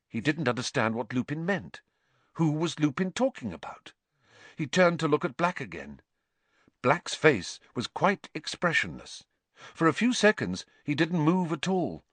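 ordinary delivery, nothing marked

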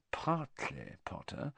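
very soft, conspiratorial whisper. A narrator's reading. Malfoy confiding his scheme to Harry, whispering. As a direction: drop to a secretive hush. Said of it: "lowering his voice to a whisper".

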